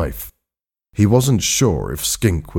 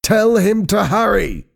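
high, urgent order; impatient; 13 semitones higher than usual. A narrator's reading. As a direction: snap it out impatiently, pitched high. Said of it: "said Doctor Blake impatiently".

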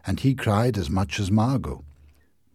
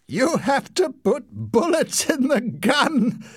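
laughing; slower than usual. A narrator's reading.

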